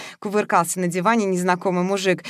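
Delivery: usual speaking voice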